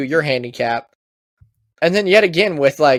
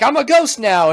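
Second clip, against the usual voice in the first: mock proud ghost; 9 semitones higher, 3 dB louder than usual. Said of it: "you're like".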